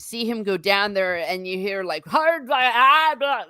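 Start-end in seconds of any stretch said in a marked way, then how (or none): 2.1-3.4 garbled mock swearing, a touch louder; half-hearted angry gibberish; mock cursing gibberish. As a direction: grumble nonsense like a cursing dad. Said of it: "sound like that".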